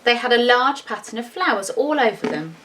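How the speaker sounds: plain reading voice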